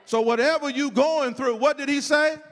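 punchy, insistent sermon cadence; forceful preaching. Real, not acted. Preacher listing whom Jesus didn't say to see, insistent preaching. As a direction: drive each item home to the congregation.

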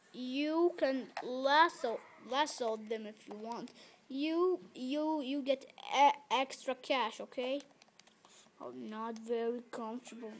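ordinary delivery

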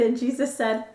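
ordinary delivery

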